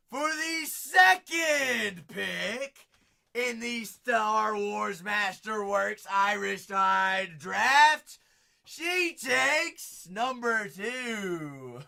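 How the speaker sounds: draft announcer. Pretend. Mock draft pick announcement for Irish, slow and loud.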